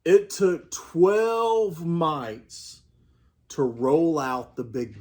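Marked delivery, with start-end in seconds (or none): none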